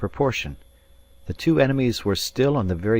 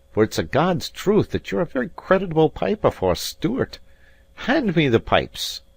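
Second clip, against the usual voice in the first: higher, louder, mocking Highlander; haughty Scots piper, raised and scornful; proud mocking Robin; 6 semitones higher, 4 dB louder than usual. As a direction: praise him with a sneer. Narrator voicing Robin.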